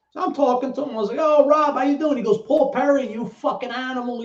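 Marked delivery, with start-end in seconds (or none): 1.2-2.2 put-on high, loud, happy hello to an old friend; bright-eyed reunion hello, pitched up; excited greeting. 2.5-4.2 pitched-up mock insult thrown with love; rowdy friendly greeting.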